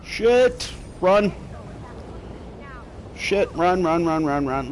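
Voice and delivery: urgent panicked run calls; panicked yelling. Real, not acted. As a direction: yell it out, panicking. Fleeing guards in game, panicking.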